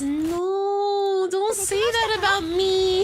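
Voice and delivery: high, squeaky pretend voice; mock offended. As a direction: play the wounded innocent.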